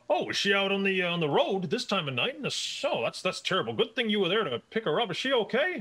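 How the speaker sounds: high, fast, fretting manager voice; fussing manager. Acted. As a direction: fuss over the girl as the manager.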